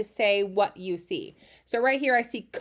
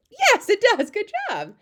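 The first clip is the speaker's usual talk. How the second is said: high, delighted cheer; excited praise; 11 semitones higher, 6 dB louder than usual. Pretend. Teacher cheering a young learner's correct quiz answer, excited and high. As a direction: celebrate the right answer in a much higher voice.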